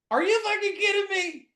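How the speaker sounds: high, loud burst of disbelief; loud, high-pitched incredulity; incredulous outburst. Retold or imitated. Mock disbelief at what Nick said.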